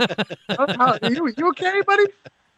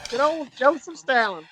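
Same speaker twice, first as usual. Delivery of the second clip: put-on Georgian accent, meaning US South; 5 semitones higher than usual. Mock Stalin telling Joe he's growing old, Southern Georgia accent. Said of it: "I'll do the Georgian accent".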